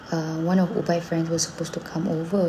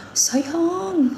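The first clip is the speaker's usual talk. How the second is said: high call up the stairs; 11 semitones higher than usual. Retold or imitated. Son calling down to breakfast, high shout. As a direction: sing out high to the wife. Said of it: "Dia jerit".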